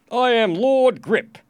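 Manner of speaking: slow, high, loud proclamation; lordly, drawn-out introduction; pompous lord. A narrator's reading. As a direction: announce yourself grandly. Rich lord introduces himself.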